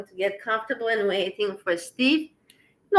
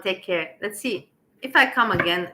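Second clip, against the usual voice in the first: ordinary delivery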